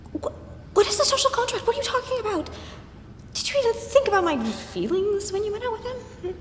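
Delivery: high, loud, incredulous demand; angry indignation. Acted. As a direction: voice jumping up in outrage. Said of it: "how angry she was getting".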